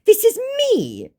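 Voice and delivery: high, stretched, insistent mock claim; mock scammer. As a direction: exaggerate the impostor's plea, high and slow. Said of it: "he claims".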